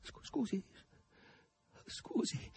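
small, high, hushed apology; falter, then fade to breath; subdued murmur. A narrator's reading. Margherita, now cowed, apologises to Azasello, murmuring. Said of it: "mormorò Margherita, ormai soggiogata".

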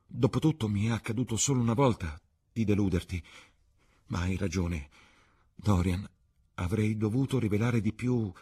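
put-on high-pitched, imploring young actress; pleading Sibyl. A narrator's reading. Sibyl at Dorian's feet begging him to stay, pleading.

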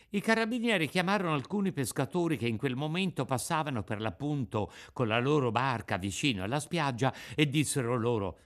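ordinary delivery

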